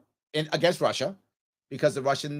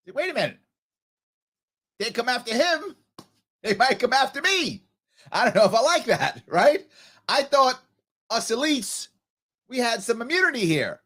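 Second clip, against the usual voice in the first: worried Cuomo voice; 7 semitones higher, 3 dB louder than usual. Retold or imitated. Voicing Cuomo's worried thoughts.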